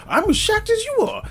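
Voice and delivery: very high-pitched mock surprise; feigned shock. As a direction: plead innocence with exaggerated shock. Acts out an excuse to partner.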